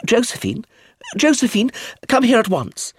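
high, summoning call. A narrator's reading. Aunt calls Josephine indoors, calling out sharply. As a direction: call a child in from across the garden. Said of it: "Edith de Havilland's voice calling".

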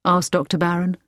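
plain reading voice

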